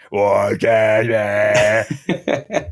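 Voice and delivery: slow robot voice shout. Shown as a demonstration. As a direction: drag it out like a robot filter. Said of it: "a robot voice filter on him".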